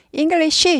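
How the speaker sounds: English as ing-geul-li-shi, a vowel at the end. Shown as a demonstration. Teacher showing the wrong ending, English with shi. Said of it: "시, 시 아니고 쉬".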